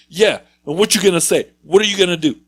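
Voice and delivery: loud, high challenge to a fight; taunting yell. Retold or imitated. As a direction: bark it out like a kid picking a fight.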